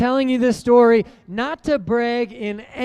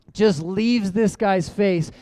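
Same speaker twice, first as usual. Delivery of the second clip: normal talking voice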